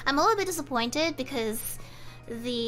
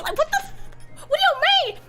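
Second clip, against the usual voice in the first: shocked outburst; 13 semitones higher, 3 dB louder, faster than usual. Real, not acted. Streamer reacting to the chatbot's rude question, shocked and high. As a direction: pitch jumps way up, what the.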